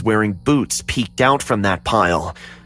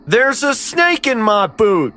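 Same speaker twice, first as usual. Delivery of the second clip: talking cowboy doll; 11 semitones higher than usual. Acted. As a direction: chirp it like a pull-string toy. Pull-string cowboy doll talks.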